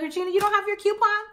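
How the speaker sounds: high, quick surprised boss; teasing her employee, voice up high; boss lady voice. Retold or imitated. Retelling her millionaire boss asking at lunch, high quick surprised voice. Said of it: "she'll be like".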